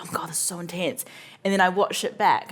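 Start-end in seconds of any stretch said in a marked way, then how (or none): 0.0-1.0 fast hushed whisper. Retold or imitated. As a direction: whisper it quickly. Retold intense filming thought.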